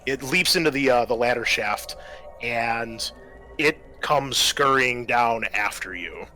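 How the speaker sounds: ordinary delivery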